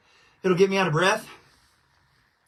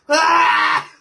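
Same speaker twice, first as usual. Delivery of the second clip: loud pained gasp; 9 semitones higher, 11 dB louder than usual. Real, not acted. After a hard coughing fit.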